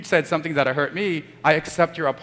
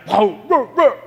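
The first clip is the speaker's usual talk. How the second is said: imitating a louder barked whoa; mock barking; 6 semitones higher, 6 dB louder than usual. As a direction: bark it like a heated argument. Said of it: "we're barking at each other like".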